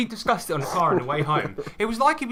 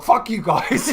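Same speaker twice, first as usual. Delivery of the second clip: raised, laughing imitation of a huff; hammed-up cross retort, higher-pitched; mock angry outburst; 6 semitones higher, 4 dB louder, slower than usual. Friend imitating wound-up Ryan snapping at the group, mock angry.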